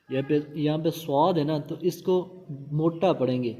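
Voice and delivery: ordinary talk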